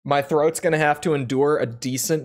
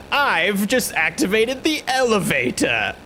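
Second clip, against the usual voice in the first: cartoon villain voice; 5 semitones higher than usual. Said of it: "the goofy cartoon villain voice".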